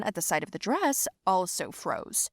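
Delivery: usual speaking voice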